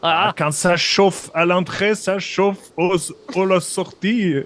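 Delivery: put-on high-pitched comic German accent. Impostor chatting with the guard about chili, German accent. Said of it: "il parle en français avec un accent allemand".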